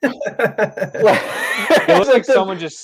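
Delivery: slow, high words through real laughter; words squeezed out between laughs; laughing. Real, not acted. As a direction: talk while laughing hard. Laughing at the long word.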